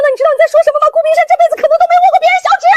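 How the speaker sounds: high, loud, rapid-fire squeal; utter astonishment. Acted. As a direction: gabble in shrieking disbelief. Colleague astonished by the confession. (震惊尖声连珠炮)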